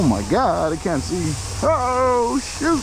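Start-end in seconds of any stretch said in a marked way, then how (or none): 1.6-2.8 high startled yelp. Real, not acted. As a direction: caught off guard on the bike. Can't see on the trail.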